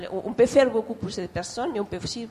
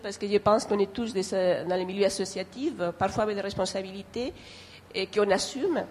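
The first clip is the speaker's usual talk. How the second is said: ordinary delivery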